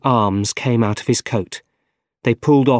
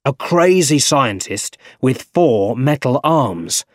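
emphatic shout, building higher and louder; shouting boss; 3 semitones higher, 4 dB louder than usual. A narrator's reading. Editor demanding a name from staff, shouting. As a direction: boom it out across the office. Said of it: "he shouted".

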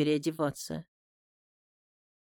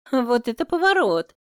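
higher, louder playful sister's exclamation; amused little sister; 7 semitones higher, 5 dB louder than usual. A narrator's reading. Sister teasing her brother, amused grin. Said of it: "улыбается Сонька".

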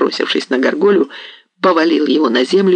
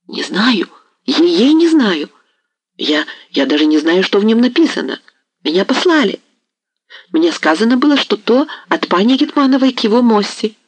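frightened plea; 6 semitones higher than usual. A narrator's reading. Merchant pleading in fear.

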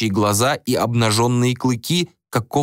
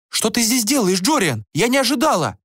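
high-pitched female voice, surprised; exclaiming queen; 12 semitones higher than usual. A narrator's reading. Queen holding the dog questions Jorian, high surprised exclamation. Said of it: "Эстрильдис воскликнула".

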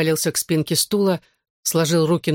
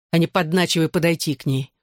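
usual speaking voice

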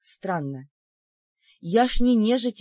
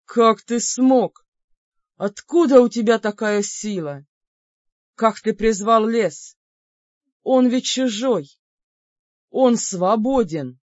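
slow, loud demon disbelief; bellowing demon; 6 dB louder, slower than usual. Acted. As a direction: roar out the questions.

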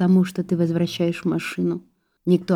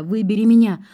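higher, acted begging outburst; pleading cry, pitch raised; desperate plea; 4 semitones higher, 4 dB louder than usual. A narrator's reading. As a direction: beg urgently. Max begs her to choose him.

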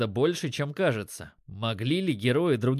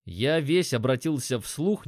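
plain reading voice

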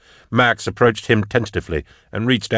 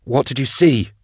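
higher-pitched, urgent question; insistent repeated question; 6 semitones higher than usual. A narrator's reading. Drusha pressing the dying hunter, insistent. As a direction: press for an answer, pitch raised. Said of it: "Drusha repeated".

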